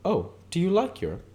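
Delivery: very high eager exclamation; light up at Europe; exclaiming. Acted. Catherine exclaims to Nick, high. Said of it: "she exclaimed".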